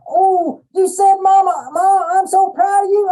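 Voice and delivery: high, loud, excited squeal; praising baby. Retold or imitated. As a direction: gush over the baby's first word.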